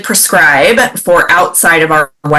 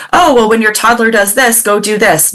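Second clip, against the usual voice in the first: mock generic advice; 5 semitones higher than usual. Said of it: "a bunch of stuff that's like".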